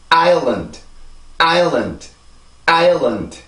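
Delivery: island as EYE-lund, big beat then small beat; English two-syllable rhythm. Shown as a demonstration. Teacher modelling for repetition, island as two-beat EYE-lund. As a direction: stress island's first vowel, end on a bare D. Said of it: "이 단어는 그렇게 발음을 해요".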